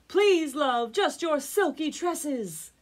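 loud, high plea shouted upward; comic lovestruck shout, slowing at the end; pleading call. A narrator's reading. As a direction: beg her from far below. Prince pleads up to the tower.

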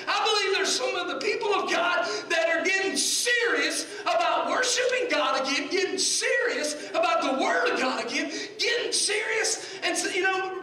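high, ringing pulpit delivery; full-force preaching. Real, not acted. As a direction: preach it out with rising fervour.

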